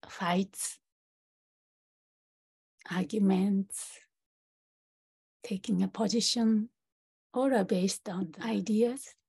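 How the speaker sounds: calm meditation guide, long pauses between phrases; guided meditation. Acted. Teacher leading practitioners through a short meditation, guided meditation voice. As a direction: guide them gently inward, leaving space after each phrase.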